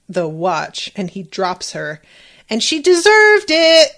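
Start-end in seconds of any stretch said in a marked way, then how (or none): 2.5-3.9 gleeful outburst. Real, not acted. Gleeful at the character's comeuppance.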